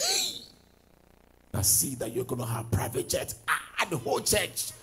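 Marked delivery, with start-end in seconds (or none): 0.0-0.4 high startled exclamation; a sharp high cry of amazement; surprised hey. Retold or imitated. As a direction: yelp it in sudden surprise. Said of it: "I was like".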